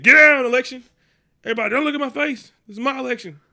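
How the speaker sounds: loud, high hold-up voice; mock robbers. Democrats stealing the election, as loud high robbers. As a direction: bark it like a stickup. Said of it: "they, they, they said".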